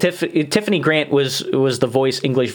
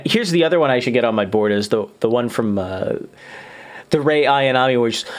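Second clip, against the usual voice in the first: ordinary delivery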